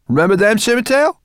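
high, loud, cheeky brownie voice; squeaky brownie. A narrator's reading. Brownie answering the dragon, high and cheeky. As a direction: pipe it up like a bold little brownie.

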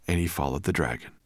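ordinary delivery, nothing marked

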